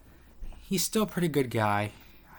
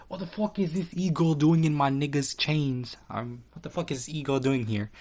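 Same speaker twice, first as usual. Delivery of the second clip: higher, mock jealous snap; jealous outrage; 5 semitones higher than usual. Haley seeing the e-girl in Sauce's chat, higher jealous snap. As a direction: play Haley jealous of the newcomer. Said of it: "and Haley's like".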